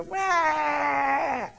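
put-on long high-pitched baby "waah"; imitated tantrum wail. Preacher imitates a child demanding things, mock tantrum wail. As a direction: bawl like a toddler wanting something, hammed-up. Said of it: "they want the things and they go".

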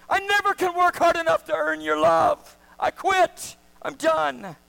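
high, loud desperate cry to God. Acted. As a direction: cry out in surrender, voice straining. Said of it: "we cry out".

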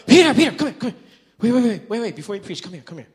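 loud, high, urgent interruption; frantic calling out. Acted. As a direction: stop him before he walks off, shouting. Ian interrupts a third time.

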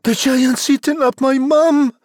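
very high-pitched, monotone character cry; Jack crying out. A narrator's reading. Jack reporting that the giant ate his mum, high cry. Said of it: "Jack cried".

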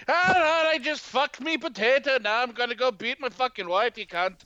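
mock Irish. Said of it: "You're just like".

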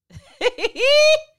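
can't hold back a shrieking laugh; howling laugh at the clip; loud high laugh. Real, not acted. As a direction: burst out in a loud, high cackle.

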